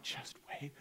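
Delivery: muttered knowing whisper; whispered aside. Retold or imitated. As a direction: whisper "Just wait" under the breath. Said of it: "I'm like".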